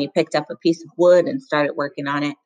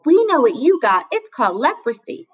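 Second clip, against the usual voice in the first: taunting; 8 semitones higher, 4 dB louder than usual. Acted. Bully keeps taunting Rob.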